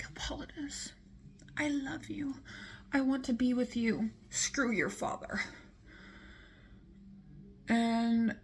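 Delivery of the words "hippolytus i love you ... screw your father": higher, soft whispered confession; breathy love confession. Retold or imitated. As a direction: coo it like a smitten queen. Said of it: "She was like".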